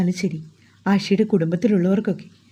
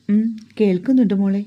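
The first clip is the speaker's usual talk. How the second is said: ordinary delivery, nothing marked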